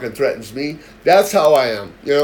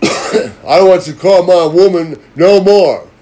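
loud, higher mock tough-guy warning; imitating the thug boyfriend; 5 semitones higher, 11 dB louder, slower than usual. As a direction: ham up a dim, threatening boyfriend. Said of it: "He sounds like he has a third grade education".

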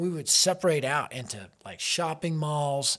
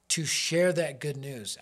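usual speaking voice

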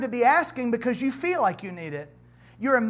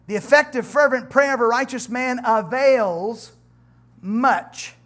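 scripture reading; 2 semitones higher, 5 dB louder than usual. Acted. Reads James 5 scripture aloud.

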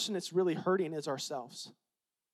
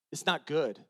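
normal talking voice

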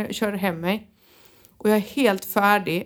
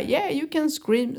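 quoted therapist; 6 semitones higher, slower than usual. Retold or imitated. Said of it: "sa hon".

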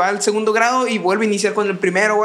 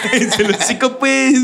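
laughing echo; 4 semitones higher, slower than usual. Retold or imitated. Echoing friend's line laughing.

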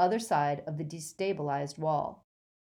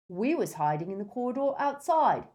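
higher-pitched dim henchman; grunting Goyle; 6 semitones higher than usual. A narrator's reading. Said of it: "grunted Goyle".

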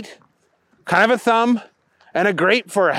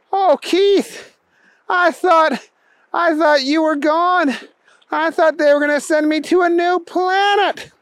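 high-pitched emotional outpouring; relieved Booger; 12 semitones higher than usual. A narrator's reading. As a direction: pour out your relief to your buddy. Relieved Booger hugs Keith.

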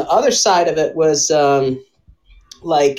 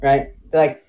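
ordinary delivery

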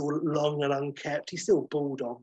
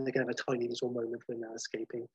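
usual speaking voice

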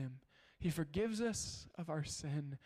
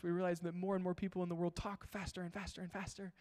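speeding up; faster than usual. Shown as a demonstration. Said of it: "even as I'm talking really fast".

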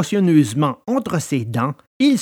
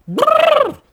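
high shivering brrr; puppet's shiver. A narrator's reading. Pinocchio imagining being fried, high fearful shiver. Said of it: "J'en ai des frissons".

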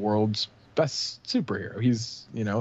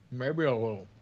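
talking mid-sip; words round a sip of water; while sipping a drink; 4 dB quieter than usual. Real, not acted. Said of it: "I'm drinking water".

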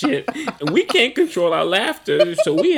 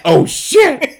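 loud, higher startled cry; startled exclamation; 8 semitones higher, 9 dB louder than usual. Retold or imitated. Dad reliving the spit moment: loud, startled outburst. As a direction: blurt it out in shock. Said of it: "I said".

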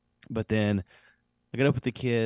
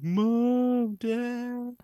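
put-on high, wailing child's call for mom and dad; a boy with a nosebleed crying for his parents, pitched high; crying kid. A son calling out to his parents upstairs, a high crying wail. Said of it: "And so he starts crying".

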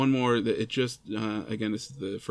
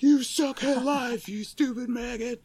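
high, slow mock drill instructor bark; drill sergeant; 12 semitones higher, slower than usual. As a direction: yell down at a recruit, hammed up. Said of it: "a drill instructor came out and he was like".

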